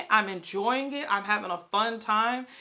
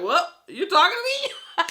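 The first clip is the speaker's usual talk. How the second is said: much higher, laughing what you talking to me; hammed-up indignant squeak through a laugh; sassy mock outrage; 10 semitones higher, 7 dB louder than usual. Violet reacting to the dragon, high mock-offended laugh. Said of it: "she's like".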